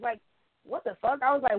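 normal talking voice

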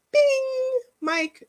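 high, singsong mock wish-granter; magician granting wishes. Vlogger mocking the idea of God as magician, high singsong proclamation.

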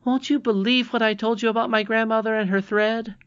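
very high, excited princess voice; high-pitched, eager appeal; triumphant cry. A narrator's reading. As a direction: press him triumphantly for an answer. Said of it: "she cried".

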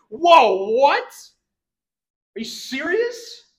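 loud, high, slow disbelief; incredulous. Pretend. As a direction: stretch out the shock, as if you can't believe it. Preacher reacts to the servant.

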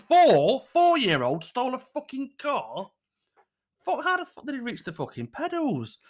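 high-pitched disbelieving outburst; incredulous. Real, not acted. Host reacting to a child driver, incredulous. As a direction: let the voice leap up in disbelief.

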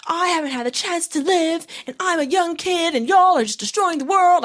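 high-pitched imitation of a kid going off; Greta Thunberg. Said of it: "Like, she was going off".

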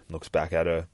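normal talking voice

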